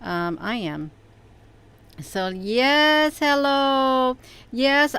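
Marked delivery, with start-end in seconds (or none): 2.0-5.0 bright greeting. Real, not acted. Greeting viewers after fixing stream.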